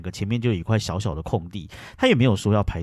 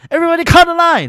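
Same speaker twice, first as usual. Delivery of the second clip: very high, loud outraged yell; 11 dB louder than usual. Retold or imitated. Calling out the queue jumpers, loud high yell. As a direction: yell it for the whole hall to hear. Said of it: "我就吼超大声的这样".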